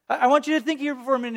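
ordinary talk, nothing marked

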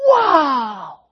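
high, loud, amazed wow; full of emotion. Shown as a demonstration. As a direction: burst out in wonder. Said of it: "we could do it through our emotion".

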